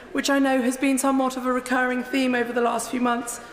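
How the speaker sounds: ordinary talk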